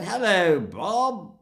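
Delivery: high, loud, swooping hello; breezy upper-middle-class boy's greeting; cheerily patronising, pitched high and ringing; cheerful condescension. Retold or imitated. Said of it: "that cheerful condescension of well-educated, upper middle class boys".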